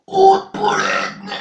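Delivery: belched out slowly, high and loud; one long burp carrying the words. Shown as a demonstration. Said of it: "Je vais vous dire haute poledne".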